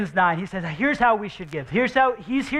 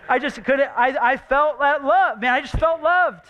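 rising, louder heartfelt gush; high-pitched emotional gush; 7 semitones higher, 4 dB louder than usual. Real, not acted. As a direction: pour out how loved you felt. Pastor gushing about feeling loved.

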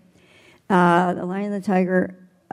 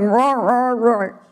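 mock roar, pinched; 3 semitones higher, 4 dB louder, slower than usual. Shown as a demonstration. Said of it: "the lion would not be happy and he'd bite you".

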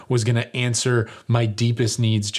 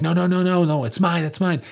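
frantic no; 7 semitones higher than usual. Retold or imitated. Dad frantically waving, stopping son.

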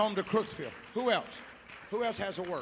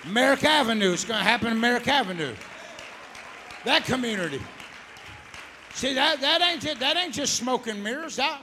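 loud, ringing preacher's cry rising higher; full-force preaching; 5 semitones higher, 10 dB louder than usual. Real, not acted. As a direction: preach it out with everything you have.

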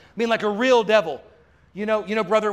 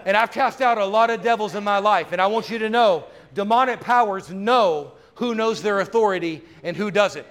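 usual speaking voice

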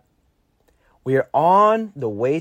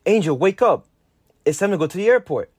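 father's shout; 7 semitones higher, 3 dB louder than usual. Retold or imitated. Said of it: "my father shouts to my face".

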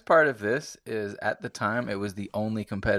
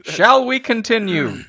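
mock grand announcer; 12 semitones higher, 11 dB louder than usual. Mock grand question, high and loud.

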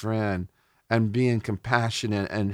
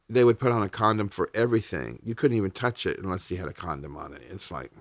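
ordinary delivery

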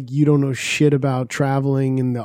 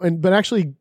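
ordinary talk, nothing marked

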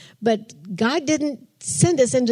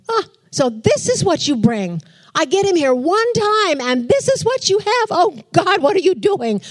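much higher, louder, rising in disbelief; exasperated rant to God; 9 semitones higher, 3 dB louder than usual. Retold or imitated. Woman fuming silently in a pew at God. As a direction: vent at God like he let you down.

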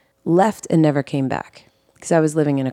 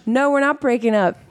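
a hint of a higher, playful boyfriend; lightly voiced boyfriend; 7 semitones higher than usual. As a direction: tease her back. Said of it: "he was like".

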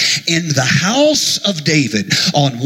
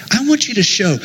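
ordinary talk

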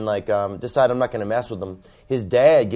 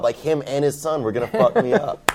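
ordinary talk, nothing marked

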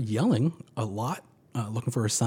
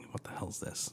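whispered; 4 semitones lower, 15 dB quieter than usual. Retold or imitated. Hearing strange cart sound.

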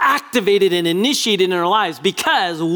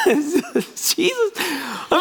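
laughing through the name, pitched up; 8 semitones higher, much slower than usual. Real, not acted. Preacher laughing at the disciples, pitched-up laugh. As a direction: let the laugh carry the word.

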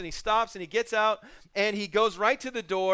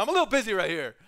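brusque brush-off; 4 dB louder, faster than usual. Retold or imitated. Naaman brushing off servant.

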